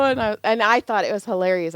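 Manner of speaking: usual speaking voice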